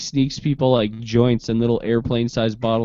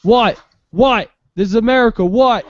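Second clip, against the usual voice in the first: higher, louder defiant taunt; mock taunting shout; 8 semitones higher, 5 dB louder than usual. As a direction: goad them loudly, rising each time. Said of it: "It's like".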